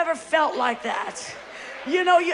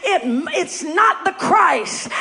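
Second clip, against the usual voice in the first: rising, ever louder preaching climax; full-force preaching; 3 semitones higher, 7 dB louder than usual. Acted. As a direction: build it up into a roaring proclamation.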